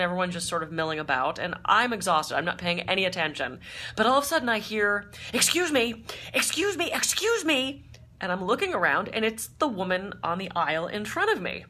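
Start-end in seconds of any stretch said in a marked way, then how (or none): 5.3-7.8 high, insistent excuse me; mock a pushy passenger calling out; demanding passenger. Passenger demanding attention on plane.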